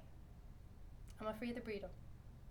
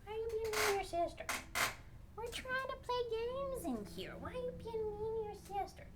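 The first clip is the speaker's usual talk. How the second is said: pet-directed scolding; 4 semitones higher, 9 dB quieter, faster than usual. Real, not acted. Owner asking the cat why it's mean to its sister, cooing scolding. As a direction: chide a pet in high, cooing tones.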